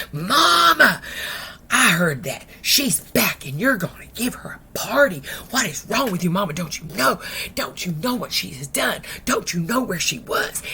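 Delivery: acted indignant daughter, loud opening cry; mad. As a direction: confront your mother in outraged protest. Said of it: "sister was so mad".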